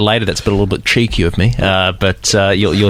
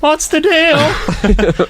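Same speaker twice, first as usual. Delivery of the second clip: Seinfeld impression; much slower than usual. Teasing the Seinfeld bit.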